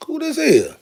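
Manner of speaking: high, eager question; thrilled to see who's next; a high, excited little question; surprised question. Real, not acted. Pulls out another jersey.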